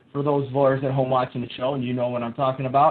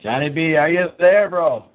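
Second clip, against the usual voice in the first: raised voice calling into the line; 7 dB louder than usual. Real, not acted. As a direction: call out to check he's there.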